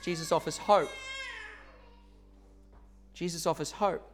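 a quiet, tender repetition, nearly broken; soft emotional. Real, not acted. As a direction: let the words fall softly, moved. Repeated softly, emotional.

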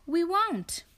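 family's reply. Acted. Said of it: "they said".

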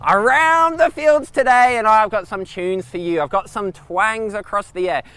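loud, high hammed-up country DJ voice; over-the-top Aussie country announcer; country radio announcer. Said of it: "Zach, an audition".

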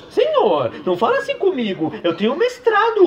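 high, whiny woman's voice; high-pitched Damares voice. Acted. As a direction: protest in a high whine.

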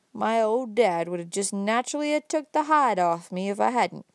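a lightly voiced old country man, rising as he goes; folksy old fellow building up his point, slowing at the end; old Uncle Bobby. Old man lecturing the young man about smoking, lightly voiced and folksy.

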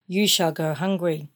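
ordinary talk

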